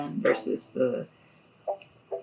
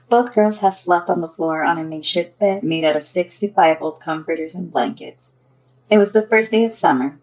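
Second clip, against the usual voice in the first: louder, projected storytelling voice; story narration; 7 dB louder than usual. A narrator's reading. Author tells of two girls waking, story narration. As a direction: read it out clearly as a storyteller.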